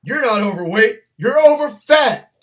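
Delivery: loud, high mock drill sergeant shout breaking into a laugh; drill sergeant bark. Drill sergeant yelling at an overweight recruit, loud mock shout. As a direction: yell it like a sergeant, cracking up at the end.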